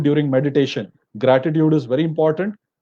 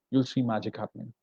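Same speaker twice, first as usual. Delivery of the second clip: "You'll see magic happening" dropped much softer, almost confiding; hushed repeat; 5 semitones lower, 11 dB quieter than usual. Real, not acted. Teacher quietly confiding a promise to students, much softer. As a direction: let the promise sink in softly.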